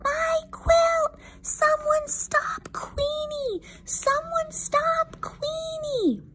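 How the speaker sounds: very high, drawn-out squeaky plea; small-voiced cry. Quiet quail begs someone to stop the dog, high thin cry. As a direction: call for help in a tiny bird squeal. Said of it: "Quincy's voice is too quiet".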